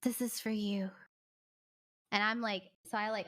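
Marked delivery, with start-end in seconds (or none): 0.0-0.9 breathless hushed whisper; mock tender whisper. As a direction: hush it like a secret gift. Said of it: "He's like".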